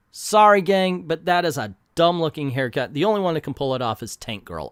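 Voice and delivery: normal talking voice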